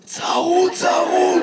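high, hoarse reply. Real, not acted. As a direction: push the word out through a hoarse throat. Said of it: "ממה אתה צרוד". (תשובה בקול צרוד)

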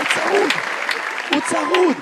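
mock hoarse croak. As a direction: strain the words as if hoarse. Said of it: "אתה צרוד".

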